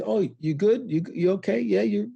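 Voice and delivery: high, breezy are-you-ok; casual check-in. Retold or imitated. People checking on a veteran, lightly voiced high and breezy.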